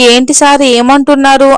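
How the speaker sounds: ordinary delivery, nothing marked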